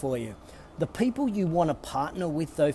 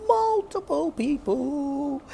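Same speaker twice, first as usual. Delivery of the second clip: slow and much higher; drawn-out high emphasis; 12 semitones higher, much slower than usual. Real, not acted. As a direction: stretch it out high and sing-song. Repeats phrase for emphasis.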